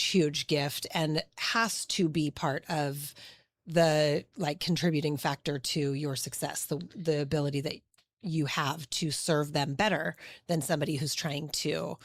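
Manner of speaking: ordinary talk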